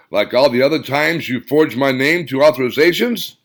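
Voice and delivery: loud sarcastic bark. Acted. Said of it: "Steve barked into the phone".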